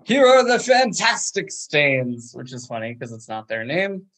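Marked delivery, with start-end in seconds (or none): 0.0-2.3 high, loud band introduction; imitated hype-man shout; stage announcement. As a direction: announce the act to a crowd. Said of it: "Lawn Boy announces".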